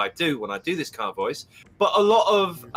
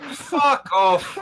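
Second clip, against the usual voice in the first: mock Irish; 5 semitones higher, 3 dB louder than usual. Hammed Irish retort.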